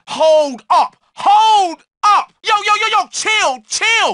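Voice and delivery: high, loud urgent calls to stop; alarmed shouting. Acted. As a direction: yell at him to back off. Stopping a fight, alarmed.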